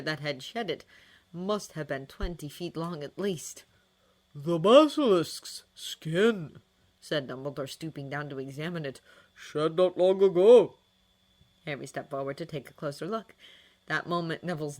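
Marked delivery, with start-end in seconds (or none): 4.3-6.6 put-on drawn-out, grave old wizard; slow old wizard voice. A narrator's reading. 9.3-10.7 put-on drawn-out, grave old wizard; slow old wizard voice. A narrator's reading.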